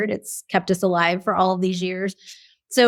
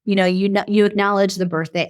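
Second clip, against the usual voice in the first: ordinary talk, nothing marked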